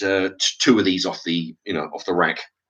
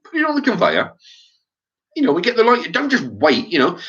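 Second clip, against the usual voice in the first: higher, incredulous grumble in his head; exasperated inner thought; 9 semitones higher than usual. Retold or imitated. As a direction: voice the annoyed thought, pitched up. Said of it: "This is what I'm thinking to myself".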